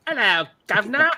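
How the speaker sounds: loud, high cartoon English accent; mock English accent. Capping the son's accent story, mock English accent. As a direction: ham up a bad English greeting. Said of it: "do your English accent".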